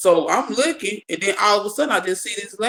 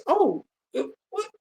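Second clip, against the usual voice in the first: startled; 10 semitones higher, 5 dB quieter than usual. Retold or imitated. Startled by ghost child.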